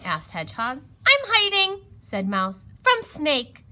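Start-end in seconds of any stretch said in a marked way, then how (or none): 1.1-1.8 high, loud little mouse; urgent high-pitched mouse voice; anxious mouse. A narrator's reading. Frightened Mouse hiding from Snake. 2.8-3.6 high, urgent mouse voice; loud, high little mouse; anxious mouse. A narrator's reading. Frightened Mouse hiding from Snake.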